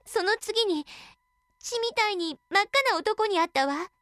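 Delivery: put-on high, frightened young girl; a scared girl blurting it out. Girl reports in fear.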